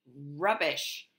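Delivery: king cutting in impatiently; gruff interruption. Acted. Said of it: "interrupted the king".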